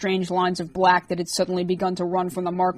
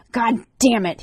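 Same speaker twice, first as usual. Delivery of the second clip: hissed curse; 4 semitones higher, 3 dB louder than usual. A narrator's reading. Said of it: "he hissed".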